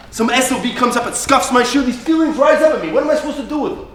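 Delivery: high, heated and aggrieved; rising agitation. Acted. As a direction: let the temper build as you speak. Confessing uncontrollable temper.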